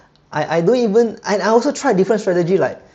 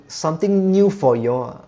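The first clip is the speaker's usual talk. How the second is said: ordinary talk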